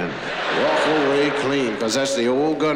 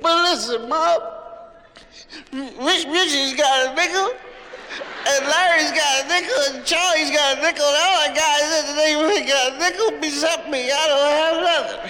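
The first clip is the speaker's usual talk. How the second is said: put-on a little boy's high wheedling complaint; whining kid; 12 semitones higher than usual. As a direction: plead like the only boy without a nickel.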